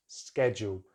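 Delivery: "schedule" starting with sked; American "schedule" as SKED-jool; American pronunciation. Shown as a demonstration. Teacher shows the American way, "schedule" as SKED-jool. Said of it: "in American English, they have the sked".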